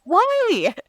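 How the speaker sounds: high, squeaky disbelief; why, shrieked in disbelief; incredulous why. Real, not acted. Echoing her friend with a high incredulous why.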